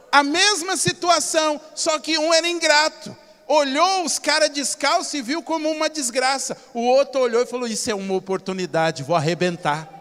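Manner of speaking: loud preacher's climax, driving it home; preaching at full force. Acted. Preacher to church, driving the lesson home loudly. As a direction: hammer the point to the congregation.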